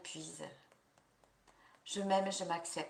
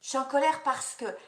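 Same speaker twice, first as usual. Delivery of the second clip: higher and louder, with force; raised, indignant declaration; demonstrated anger; 4 semitones higher, 5 dB louder than usual. Shown as a demonstration. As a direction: voice the anger out loud. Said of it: "dites pourquoi vous êtes en colère".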